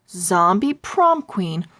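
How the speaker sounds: ordinary delivery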